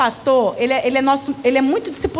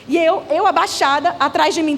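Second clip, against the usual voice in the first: ordinary talk, nothing marked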